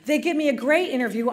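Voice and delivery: ordinary delivery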